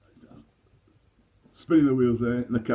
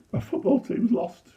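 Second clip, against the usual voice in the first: put-on tearful kid; 10 semitones higher than usual.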